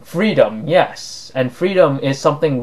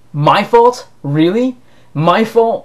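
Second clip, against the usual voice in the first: indignant disbelief; 6 semitones higher, slower than usual. Real, not acted. Indignant rebuttal to believers.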